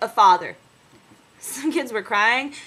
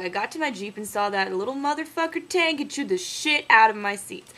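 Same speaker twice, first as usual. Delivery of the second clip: ordinary talk, nothing marked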